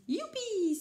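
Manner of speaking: high, loud happy whoop; excited cry. Acted. Rikili cheering about yogurt dessert, high excited whoop. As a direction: cheer like a thrilled kid. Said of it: "s'écrie".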